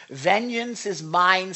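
slow, weighty quote; solemn drawn-out declaration; slow solemn scripture. Acted. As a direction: pronounce it slowly like God's decree. Quoting scripture.

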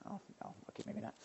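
a hushed, embarrassed whisper to oneself; whispered aside. Real, not acted. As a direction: take it back under your breath.

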